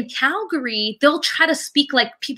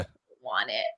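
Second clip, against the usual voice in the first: mock refusal; 13 dB quieter than usual. Refusing the imagined cold.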